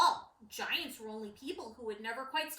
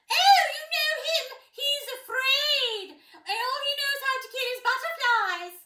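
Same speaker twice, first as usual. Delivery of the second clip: much higher, louder jeering voice; mocking courtier; 13 semitones higher, 11 dB louder than usual. Courtier mocks Prince Guy to others, high and jeering. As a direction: sneer at the timid prince.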